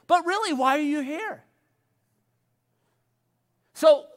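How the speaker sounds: normal talking voice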